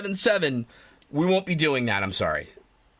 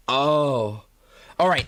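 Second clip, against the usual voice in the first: ordinary delivery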